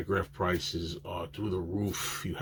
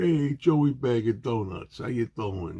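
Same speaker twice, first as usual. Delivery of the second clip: put-on higher, louder New York accent on "Hey, Joey Bag of Donuts"; 3 semitones higher, 4 dB louder, slower than usual. Actress greeting a fan at the signing, imitated New York accent. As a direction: greet him like a New Yorker, imitating her. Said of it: "she was putting the accent on".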